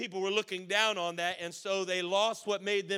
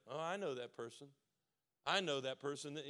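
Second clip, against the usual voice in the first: quiet aside; 5 semitones lower, 8 dB quieter than usual. Acted. Voicing a churchgoer's private recognition.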